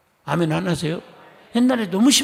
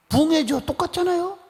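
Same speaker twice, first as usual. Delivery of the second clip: rising exclamation; 11 semitones higher than usual. Real, not acted. Preaching that services are revivals.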